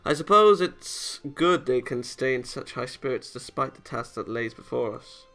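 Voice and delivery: normal talking voice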